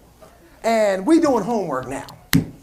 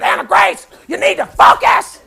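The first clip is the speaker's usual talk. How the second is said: loud, higher mock yell at the kid; wife losing it; 8 semitones higher, 7 dB louder than usual. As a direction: snap at the child to focus, hammed-up. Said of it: "I just hear my wife lose it".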